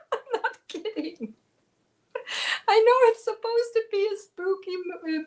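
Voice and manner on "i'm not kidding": ordinary delivery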